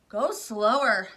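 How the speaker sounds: loud, slow breathless plea; panted plea. Acted. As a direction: gasp it out winded. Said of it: "panted Missus Salt".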